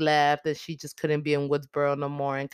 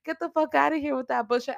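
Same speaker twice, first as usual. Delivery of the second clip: much higher, outraged "get out"; angry order; 9 semitones higher, faster than usual. Retold or imitated. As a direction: throw her out with a high, angry voice. Sister ordering Sam out.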